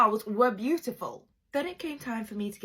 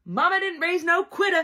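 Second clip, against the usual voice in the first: high and loud, full of sass; sassy defiance; 9 semitones higher, 7 dB louder than usual. Real, not acted. Bride telling viewers she won't back down, loud and sassy. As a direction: tell them nobody's quitting today.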